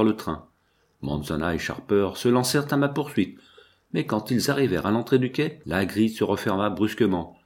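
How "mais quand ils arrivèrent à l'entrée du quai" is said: ordinary delivery, nothing marked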